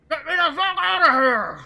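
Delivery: yelling from inside a coffin, trapped; muffled shout. Acted. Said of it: "a muffled voice that's".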